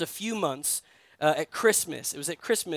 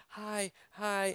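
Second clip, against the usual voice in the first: awkward mumbled hi; 2 semitones higher, 10 dB quieter than usual. Pretend. Awkward greeting at strangers' table.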